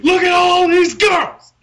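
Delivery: high, slow wrestling-promo shout; Ric Flair impression. Showing a friend his wrestler impression, shouting. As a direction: bellow it like a strutting wrestler. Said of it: "I can do Ric Flair".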